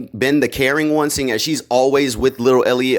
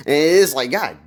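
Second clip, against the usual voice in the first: ordinary talk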